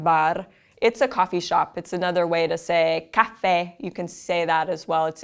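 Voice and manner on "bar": bar, short A and tapped R; Italian bar, tapped R; Italian pronunciation. Shown as a demonstration. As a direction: say bar the Italian way once more. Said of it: "In Italian, it sounds a little different".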